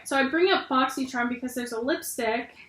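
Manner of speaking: usual speaking voice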